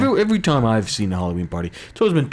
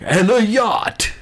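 Robin Leach impression; 9 semitones higher, much slower than usual. Cohost imitating Robin Leach's showy announcer voice. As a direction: stretch it out like a lavish TV host. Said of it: "The, the guy was like".

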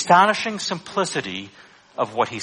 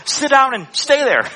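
higher, louder stern telling-off; scolding parent; 9 semitones higher, 4 dB louder than usual. Retold or imitated. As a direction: scold an unruly child. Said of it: "how you would talk to an unruly child".

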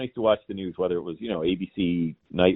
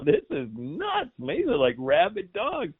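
slow, much higher laughing "this is nuts"; laughing disbelief; 13 semitones higher, slower than usual. Retold or imitated. Retelling his reaction to the press corps, laughing in disbelief. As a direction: chuckle at the absurdity, drawn out. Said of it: "it's like".

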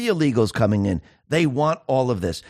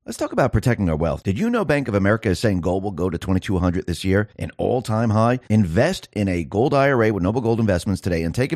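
lower, steady sponsor read; 5 semitones lower than usual. Acted. Host reads gold investment ad to listeners, smooth ad voice. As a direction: sell it like a radio spot.

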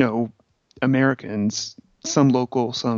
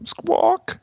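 parrot squawk. Retold or imitated. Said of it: "a very Gilbert Gottfried type voice".

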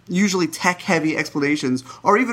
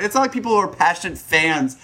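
ordinary talk

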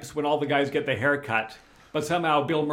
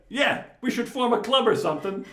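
quoting De Niro; 9 semitones higher, 3 dB louder than usual. Retold or imitated. De Niro's reply retold.

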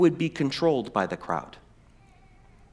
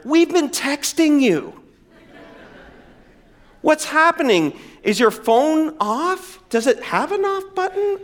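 exasperated disciples; 9 semitones higher than usual. Retold or imitated. Modern disciples voiced.

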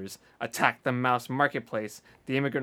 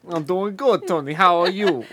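louder, hammed-up New York "how are you"; mock New York greeting; 5 semitones higher, 6 dB louder than usual. Friends trading New York greetings as Tony, hammed up. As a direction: ham up a Brooklyn buddy.

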